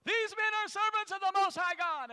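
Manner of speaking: put-on high, loud shrill cry of the possessed girl; shouting. Slave girl crying out after Paul's group, loud high shout. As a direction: yell it after them down the street. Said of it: "She's going".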